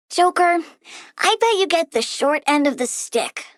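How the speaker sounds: usual speaking voice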